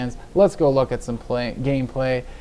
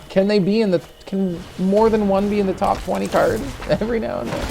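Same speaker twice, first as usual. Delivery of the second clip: exasperated pleading; 8 semitones higher than usual. Real, not acted. Frustrated at bad draws.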